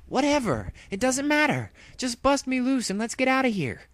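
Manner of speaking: high, loud, impatient pony agent; brash hero voice. Acted. Captured Dash to ex-partner, impatient and high. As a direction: brush it off and demand to be freed.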